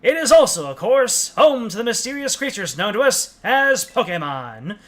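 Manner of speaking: bright, formal news-anchor read; newscaster voice. Acted. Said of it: "so I'm using my newscaster voice".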